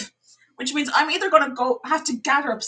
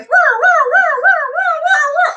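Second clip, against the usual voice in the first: much higher, louder mock siren roars; imitated alarm roar; 5 dB louder than usual. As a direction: wail it like the alarm going off. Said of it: "it's like".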